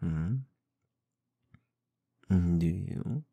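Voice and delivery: ordinary delivery, nothing marked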